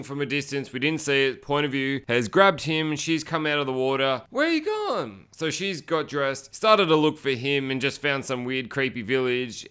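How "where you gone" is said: much higher, mock searching call; calling out searchingly. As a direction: call for a lost partner in a high voice. Acts out woman searching for partner.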